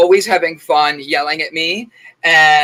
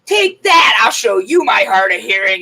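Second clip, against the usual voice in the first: higher, louder shouted taunt; mom's mock shout; 4 semitones higher, 5 dB louder than usual. Mom yelling at her son after the prank. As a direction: yell it like a triumphant prank. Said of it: "my mom's like".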